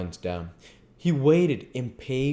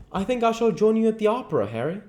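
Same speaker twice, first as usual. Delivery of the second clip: higher, quick, light voice for Dorian; young Dorian; 14 semitones higher, 5 dB louder, faster than usual. A narrator's reading. Dorian answering Lord Henry in a higher voice. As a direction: lift into a light youthful Dorian. Dorian's reply, far timbre.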